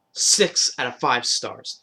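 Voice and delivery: slow, drawn-out rating; emphatic rating. Real, not acted. Fan rating the season, drawn out. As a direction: stretch every word out.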